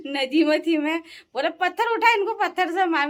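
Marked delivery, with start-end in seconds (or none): 1.5-3.1 high-pitched mock yell; retold shout. Man urging her to pick up stones, retold shout. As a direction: imitate someone calling down from above.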